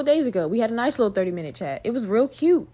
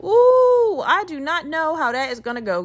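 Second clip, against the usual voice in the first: wary "ooh", pitched way up then easing down; skeptical reaction; 6 semitones higher, 4 dB louder than usual. Retold or imitated. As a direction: replay a hesitant, high-pitched warning. Voicing her doubtful reaction.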